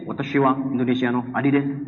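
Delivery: a hint of Japanese; mock Japanese. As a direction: imitate a Japanese greeting. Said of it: "Bahasa Jepang".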